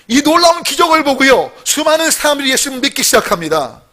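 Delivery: high, loud pulpit proclamation; impassioned preacher; full-force preaching. Real, not acted. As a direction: proclaim the miracle fervently.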